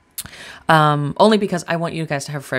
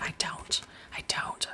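whisper; 14 dB quieter, slower than usual. Real, not acted. Confessing math weakness.

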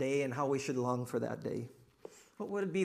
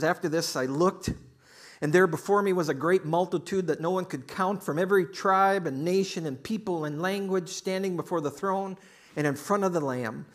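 loud, raised, driving declamation; preacher reading scripture; 4 semitones higher, 7 dB louder than usual. Acted. Pastor reads Revelation to congregation, proclaiming loudly. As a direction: proclaim the verse with force.

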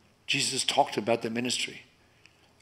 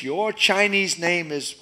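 quoting Chinese hosts; 6 semitones higher, 4 dB louder, slower than usual. Retold or imitated. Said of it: "They gave me a name".

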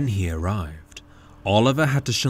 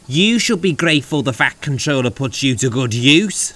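haughty snort, louder and raised; indignant, lecturing snort; 4 semitones higher, 6 dB louder than usual. A narrator's reading. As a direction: snort it at him scornfully. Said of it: "snorted James".

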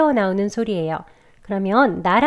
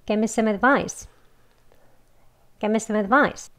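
"Give me some advice." at a quicker practice pace; 조금 더 빠르게; faster model; much slower than usual. Shown as a demonstration. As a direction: say it again a bit faster. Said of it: "빨리 더 해볼게요".

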